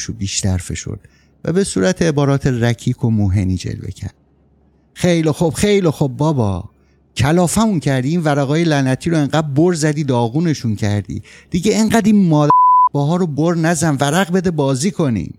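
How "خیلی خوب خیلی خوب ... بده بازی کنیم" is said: fed up, bark at him to stop shuffling; angry outburst. Acted.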